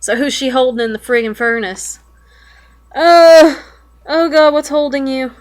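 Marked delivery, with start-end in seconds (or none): 2.9-5.3 loud, high frightened outburst; frightened cry. Real, not acted. Player seeing something grab Nan, frightened cry. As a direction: recoil in fear. Hands in the furnace.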